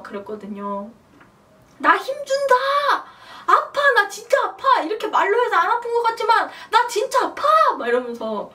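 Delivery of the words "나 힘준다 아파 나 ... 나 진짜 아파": high, loud, comic wailing shout; reenacted labor yelling. Woman narrating her labor to absent husband, reenacted yelling. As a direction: yell each update as if broadcasting the birth. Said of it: "큰 소리로 막 진통할 때마다".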